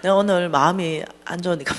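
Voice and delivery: normal talking voice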